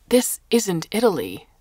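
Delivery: loud, angry snap; Carlo, stung, snapping loudly at the Japanese; savage retort. A narrator's reading. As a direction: bite the words out in anger. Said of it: "Carlo said savagely".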